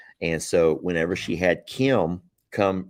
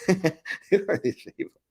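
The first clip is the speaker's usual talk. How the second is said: much higher, laughing, amused disbelief; real laughing amusement; 12 semitones higher than usual. Real, not acted. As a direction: giggle it out at the viewers. Laughing at viewers' southern goodbyes.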